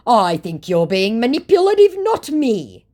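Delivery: high, loud mock accusation; mock indignation. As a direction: imitate an affronted scammer, pitched right up. Acts out scammer's indignant reply.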